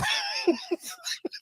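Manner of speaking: real high-pitched laugh breaking into a cry. Real, not acted. Host laughing at his own rant about the ad, high laugh. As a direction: cackle at your own joke.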